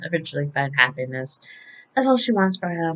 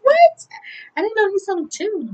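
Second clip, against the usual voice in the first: very high squealed surprise; amazed. Retold or imitated. Fan finds James's music on iTunes, amazed. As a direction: shoot the voice up in disbelief.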